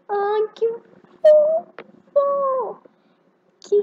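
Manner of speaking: high, loud squeal of delight; cute squeal. Real, not acted. Girl squealing at a cute shark in the game. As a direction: squeal over something adorable.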